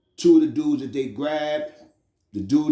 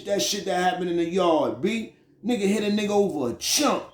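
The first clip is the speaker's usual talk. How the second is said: ordinary talk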